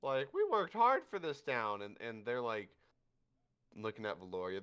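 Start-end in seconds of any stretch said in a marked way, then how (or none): 0.0-1.8 high, loud, grudging voice; high squeaky mollified crowd. Acted. Mob placated by the drinks, high squeaky grudging voice.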